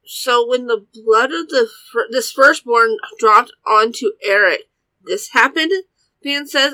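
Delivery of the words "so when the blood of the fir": usual speaking voice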